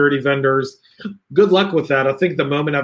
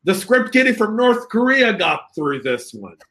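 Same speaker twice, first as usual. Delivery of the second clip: higher-pitched mock chef rant; Gordon Ramsay; 8 semitones higher than usual. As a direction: scold the vendor like Gordon Ramsay. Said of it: "Imagine Gordon Ramsay walking in".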